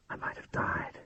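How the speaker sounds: soft, unvoiced whisper to herself; whispered thought. A narrator's reading. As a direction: breathe the words as a private thought. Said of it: "she was thinking".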